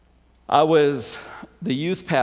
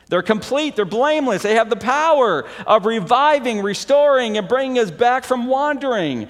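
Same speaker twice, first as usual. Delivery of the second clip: rising, louder preacher's voice; preaching; 9 semitones higher, 5 dB louder than usual. Acted. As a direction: preach it out to the congregation, building. Preaching on the scriptures.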